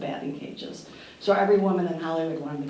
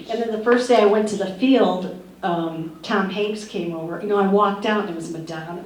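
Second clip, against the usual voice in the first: normal talking voice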